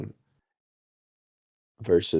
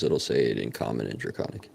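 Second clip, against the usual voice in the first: ordinary talk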